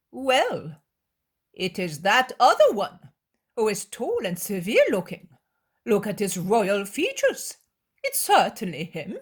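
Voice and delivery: insisting. Acted. Scribe insisting.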